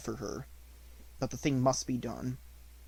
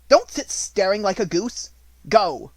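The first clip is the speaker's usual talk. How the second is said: louder, higher sharp scolding; scornful scolding; 7 semitones higher, 8 dB louder than usual. A narrator's reading. Headmistress ordering her sister out, louder and scornful. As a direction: dismiss her like a servant.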